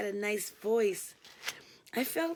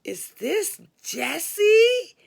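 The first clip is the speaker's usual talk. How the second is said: high loud slow disbelief; incredulous; 10 semitones higher, 10 dB louder, much slower than usual. A narrator's reading. Caller realises who answered the phone, high slow disbelief. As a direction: stretch it out, unable to believe it. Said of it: "he asked almost incredulously".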